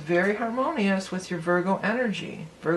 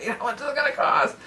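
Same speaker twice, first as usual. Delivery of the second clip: mock fretting Virgo; 7 semitones higher than usual. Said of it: "That's Virgo, right?".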